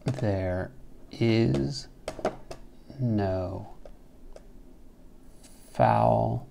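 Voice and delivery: slow, soft reading with each word spaced out; slow scansion reading. Shown as a demonstration. Teacher reads the Chaucer line word by word, slowly and softly. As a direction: space every word apart as if counting syllables. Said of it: "here's an example from the Squire's Tale".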